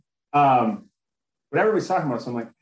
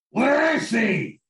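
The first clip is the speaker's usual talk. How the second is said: gravelly growled Batman shout, slow; 12 semitones higher, 4 dB louder, much slower than usual. Pretend. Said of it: "give me your best Batman voice".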